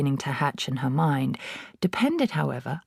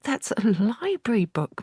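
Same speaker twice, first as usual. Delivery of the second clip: put-on higher, pleading girl's voice; a child begging her father to stop; Matilda protesting; 7 semitones higher than usual. A narrator's reading. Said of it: "Matilda cried".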